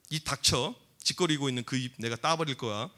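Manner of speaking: low, quiet menace; David. Acted. Young fighter threatening the giant Goliath, low menace. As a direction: keep the threat low and controlled. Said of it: "골리앗에게 강력한 소리를 냈습니다".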